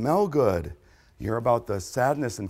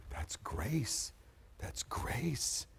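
whispered in wonder; hushed, breathless awe; awed whisper; 13 dB quieter, much slower than usual. Acted. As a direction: breathe it like an amazed crowd.

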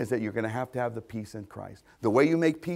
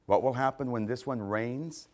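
usual speaking voice

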